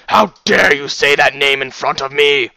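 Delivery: loud, outraged bellow; furious shout. A narrator's reading. Father outraged at a forbidden name, furious shout. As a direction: shout it in outrage, full force. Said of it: "he shouted furiously".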